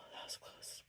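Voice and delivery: breathy relieved whisper. Real, not acted. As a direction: whisper it just after the sneeze. Recovering just after the sneeze.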